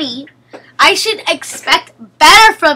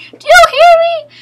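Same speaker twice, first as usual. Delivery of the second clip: yelled; 14 semitones higher, 9 dB louder than usual. Real, not acted. Yelling at teammate.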